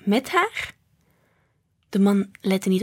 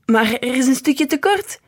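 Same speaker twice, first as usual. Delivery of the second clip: raised, higher protest climbing at the end; exclaiming; 5 semitones higher, 5 dB louder than usual. A narrator's reading. Man objecting to grandpa about the puzzle, exclaiming. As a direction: call it out in protest, louder. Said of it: "riep de man".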